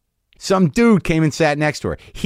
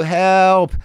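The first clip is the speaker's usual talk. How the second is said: raised pleading help; mock cry for help; 6 semitones higher, 3 dB louder than usual. Comic showing the hidden plea under the woo, raised. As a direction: squeak out a distressed help. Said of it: "Both usually women".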